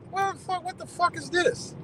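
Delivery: high, incredulous what the fuck; amazed disbelief. Retold or imitated. Retelling his shock at friendly court staff, high and incredulous. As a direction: squeak it out like you can't believe your eyes.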